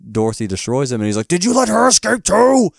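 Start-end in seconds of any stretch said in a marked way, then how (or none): 1.3-2.7 high, loud outburst as the retold villain; alarmed mock yell. Gnome King confronts Mombi about Dorothy's escape, alarmed mock yell.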